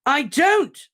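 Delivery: furious scream. Acted. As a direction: scream it at full force. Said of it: "Harry screamed so loudly".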